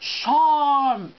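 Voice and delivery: "Shan't" stretched with the "ar" of arm; elocution-drill "Shan't" on a long "ah"; posh long A. Shown as a demonstration. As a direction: say "Shan't" as drawn-out "shahnt". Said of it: "think arm".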